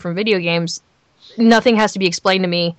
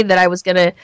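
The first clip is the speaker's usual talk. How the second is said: ordinary delivery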